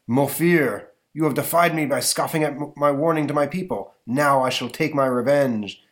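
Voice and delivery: lightly voiced vengeful villain; menacing villain. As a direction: threaten him with solemn menace. Villain Mofir threatens.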